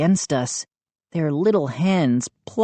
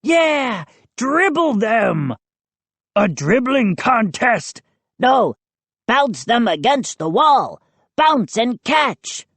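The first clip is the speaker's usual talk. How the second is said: high-pitched eager shouting out; high, gleeful gnome voices; excited gnomes; 7 semitones higher than usual. A narrator's reading. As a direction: call out wicked ideas with glee. Gnomes calling out eager ideas.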